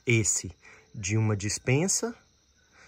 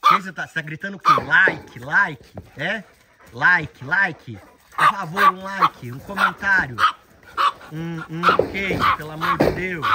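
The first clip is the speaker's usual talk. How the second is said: loud playful pleading for likes; playful loud plea for likes and comments; mock begging; 5 semitones higher, 8 dB louder than usual. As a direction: beg the viewers, hammed-up and loud. Begging likes while a bird squawks.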